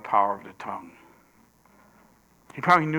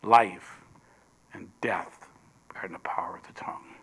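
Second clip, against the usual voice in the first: a low, hushed whisper, slowing into weight; solemn whisper; 4 semitones lower, 7 dB quieter than usual. Acted. Preacher sealing the lesson on the tongue, in a low whisper. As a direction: breathe the verse out like a secret.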